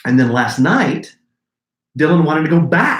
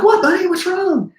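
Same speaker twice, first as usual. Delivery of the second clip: concerned dad; 3 dB louder than usual. Retold or imitated. Dad answering his crying son.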